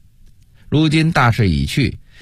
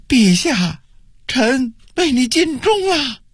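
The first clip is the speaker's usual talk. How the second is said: high-pitched tearful cry; loud wailing; 13 semitones higher than usual. A narrator's reading. Doomed official crying to his emperor, loud wailing. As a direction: wail out a last loyal farewell. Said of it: "当即一声大哭道".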